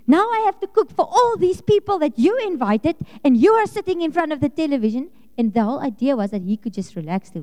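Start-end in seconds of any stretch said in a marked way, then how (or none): 0.1-5.1 resentful rant. Retold or imitated. Resentful thought while cooking for guests.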